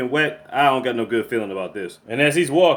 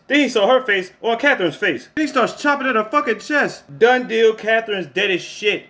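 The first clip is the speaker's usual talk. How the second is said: high-pitched, excited play-by-play of a killing; worked-up recap; 7 semitones higher than usual. Real, not acted. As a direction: recap the gory climax with rising disbelief.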